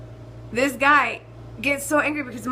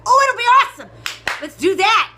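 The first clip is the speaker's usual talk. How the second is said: much higher and louder, thrilled; gleeful excitement; 10 semitones higher, 9 dB louder than usual. Real, not acted. Vlogger thrilled to read hate emails on the show. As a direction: burst out with delight at the idea.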